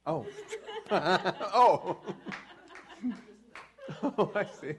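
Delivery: real laugh breaking through. Real, not acted. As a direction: laugh out the words, delighted. Amused at being the author.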